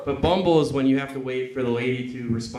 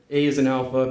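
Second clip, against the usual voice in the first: ordinary talk, nothing marked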